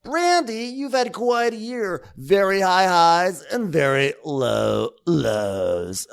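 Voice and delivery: high, slow, solemn talk-show voice; mock host. Imitating Andy opening his interview with Brandy, high and slow. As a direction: draw it out like a syrupy host.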